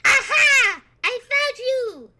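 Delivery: high, loud, slow gotcha shout; a child springing out of hiding; gleeful little-boy cry of victory; triumphant kid. Acted. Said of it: "you just hear behind you".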